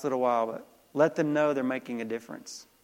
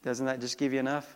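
ordinary talk, nothing marked

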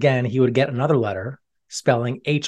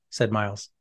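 plain reading voice

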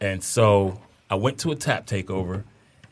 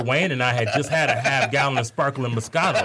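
ordinary delivery

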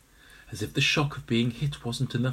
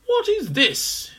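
slow high puzzled; 5 dB louder, slower than usual. Acted. Old Pater examines egg.

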